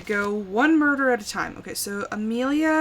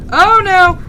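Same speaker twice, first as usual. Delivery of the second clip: alarmed cry; 8 semitones higher, 14 dB louder than usual. Real, not acted. Reacting to scare.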